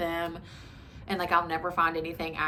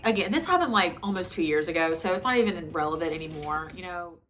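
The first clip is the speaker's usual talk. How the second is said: ordinary talk, nothing marked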